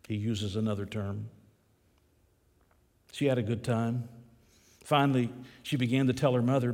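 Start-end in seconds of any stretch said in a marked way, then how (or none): none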